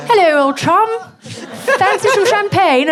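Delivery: high-pitched, exaggerated posh accent; very posh English. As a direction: put on the poshest voice, sending yourself up. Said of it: "I feel like the poshest person in the world".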